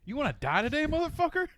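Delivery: high, loud threat hollered out; imitated threatening yell. Quoting Jared leaning out the car, threatening yell. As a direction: yell it like a sudden outburst from a car. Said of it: "Jared just leans out the car".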